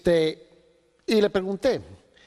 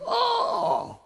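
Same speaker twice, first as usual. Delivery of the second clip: high sudden yelp of realization; a jolt of understanding, shot high; sudden startled oh. Retold or imitated. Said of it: "Y de repente saltó".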